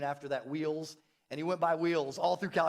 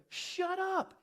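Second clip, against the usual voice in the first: high, delighted shut up; excited disbelief; 13 semitones higher than usual. Acted. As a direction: squeal it in happy disbelief. Excited reaction to shared catheter.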